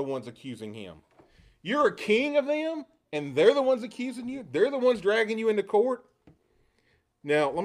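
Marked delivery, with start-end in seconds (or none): 1.6-6.0 incredulous questioning. Acted. Preacher as Pilate questioning Jesus over his accusers, incredulous. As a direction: question it in high, rising disbelief.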